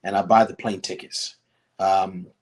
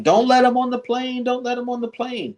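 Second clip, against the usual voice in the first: high-pitched retold scream, held on one pitch; imitated yelling; 13 semitones higher, 3 dB louder than usual. Stranger trying to block a family's boarding, imitated yelling. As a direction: yell it like a frantic objector. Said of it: "yelling and screaming".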